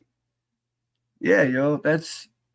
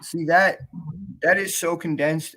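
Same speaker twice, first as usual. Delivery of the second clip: ordinary talk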